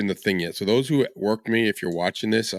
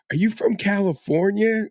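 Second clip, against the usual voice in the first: high, louder imitation of the question; curious drunk passengers; 11 semitones higher, 4 dB louder than usual. Drunk passengers quizzing the driver about his plates, high and nosy. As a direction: pitch it way up with a louder, nosy lilt.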